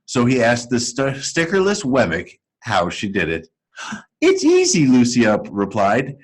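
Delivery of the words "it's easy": put-on much higher, bright girl puppet voice; a cheerful girl's puppet voice, pitched high; Lucia's voice. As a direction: lift way up into a sweet girl's voice.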